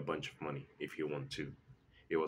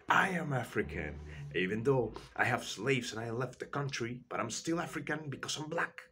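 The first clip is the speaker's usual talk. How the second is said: high, whiny mocking voice of the fighter, much higher; mocking impression; 7 semitones higher than usual. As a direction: squeak it out like a sulky brat you're making fun of.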